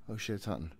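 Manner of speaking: ordinary delivery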